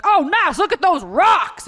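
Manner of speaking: high, very loud mock holler; hammed-up high-pitched family shout; excited tourist family. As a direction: whoop it like a gawking tourist. Mimicking family walking past.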